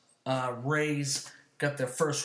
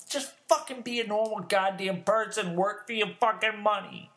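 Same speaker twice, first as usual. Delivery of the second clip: louder, higher angry rant; worked up; 8 semitones higher, 4 dB louder than usual. Real, not acted. Ranting at the card thief, worked up and loud. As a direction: let the anger boil over at the thief. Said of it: "You get me so worked up".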